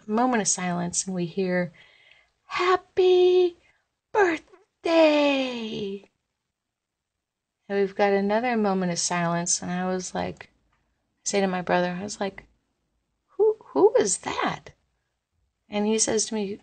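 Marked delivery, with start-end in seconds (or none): 2.5-6.1 mock ghost voice, higher and louder; eerie, barely contained excitement on each word; excited ghostly birthday wish. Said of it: "guttural, but really happy voice". 13.4-14.7 startled question. Retold or imitated. Startled by unknown voice.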